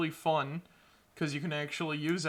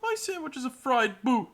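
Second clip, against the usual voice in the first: high silly voice; 8 semitones higher, 6 dB louder than usual. Acted. Silly character line.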